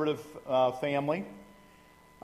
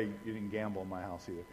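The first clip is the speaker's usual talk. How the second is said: usual speaking voice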